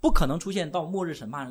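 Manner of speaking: ordinary talk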